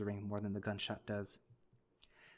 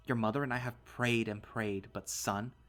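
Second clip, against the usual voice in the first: angry; 4 semitones higher, 8 dB louder than usual. Acted. Dad screaming insults.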